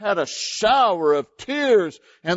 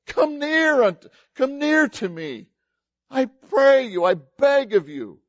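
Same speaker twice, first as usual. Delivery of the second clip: higher, louder, earnest plea; pleading Joseph; 8 semitones higher, 3 dB louder than usual. Acted. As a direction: beg your brothers to come close. Joseph pleads with his brothers.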